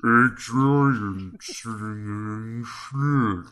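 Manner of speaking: very slow, drawn-out delivery. Shown as a demonstration. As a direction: stretch every word in slow motion. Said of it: "say that again, but slower".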